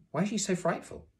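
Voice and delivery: put-on high-pitched boy's question; curious boy. A narrator's reading. Son asking his father about a woman, curious boy.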